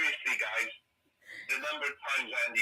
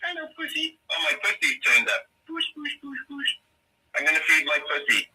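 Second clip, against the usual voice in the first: cooing to cat; 14 semitones higher, 6 dB louder than usual. Real, not acted. Calling to the cat.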